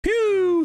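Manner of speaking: long, high whistling exhale; high whistled phew. Real, not acted. Fan watching Stoke threaten early, high whistled phew. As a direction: let out a drawn-out high "pew". Relieved noise as Stoke attack.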